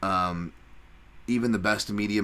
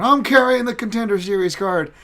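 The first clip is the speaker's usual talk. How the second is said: cocky fighter boast; 14 semitones higher, 8 dB louder than usual. Pretend. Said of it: "He wasn't out here going".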